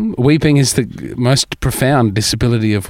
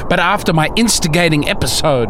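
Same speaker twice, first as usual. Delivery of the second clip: dramatic documentary narration. Acted. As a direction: narrate it like an epic nature film. Grand documentary-style recorded narration.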